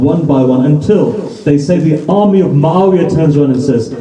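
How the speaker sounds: normal talking voice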